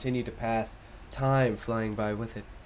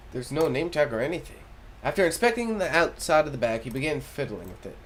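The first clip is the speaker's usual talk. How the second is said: ordinary talk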